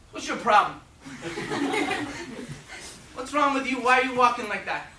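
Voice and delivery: high, loud, blunt Irish mother scolding; Irish mom. Retold or imitated. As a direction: tough love, no sympathy at all. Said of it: "all that Irish love".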